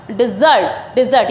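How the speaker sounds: डेजर्ट said as "di-ZURT"; z sound. Shown as a demonstration. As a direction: buzz the z in डेजर्ट, pitch falling. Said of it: "जा का sound आता है".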